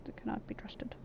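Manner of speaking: breathy whispered warning; whispering. Pretend. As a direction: whisper it like a secret. Second take, whispered.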